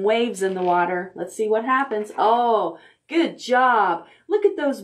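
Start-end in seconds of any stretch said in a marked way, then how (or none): none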